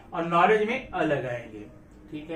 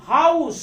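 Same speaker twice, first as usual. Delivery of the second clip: House said loud and high with a clear h; emphatic demo; 4 semitones higher, 7 dB louder, slower than usual. Shown as a demonstration. Teacher to students, house said loudly with the h. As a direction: hit house hard, h sounded. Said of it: "इसका sound है house".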